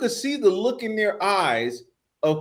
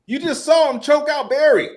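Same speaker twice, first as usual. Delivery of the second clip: incredulous, higher; 9 semitones higher, 5 dB louder than usual. Retold or imitated. Incredulous retort.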